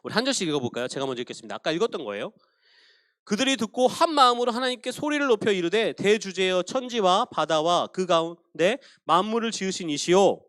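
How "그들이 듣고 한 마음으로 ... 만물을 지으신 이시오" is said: measured, solemn reading of the prayer; scripture reading. Acted. Pastor reading Acts prayer to the congregation, solemn reading.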